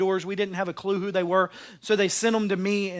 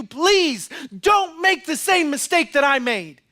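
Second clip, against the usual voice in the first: slow, louder, pleading cry; loud begging; 7 semitones higher, 5 dB louder, slower than usual. Acted. Dead father begging his family loudly. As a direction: beg them loudly and slowly. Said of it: "he is begging you".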